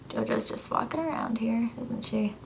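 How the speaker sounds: ordinary talk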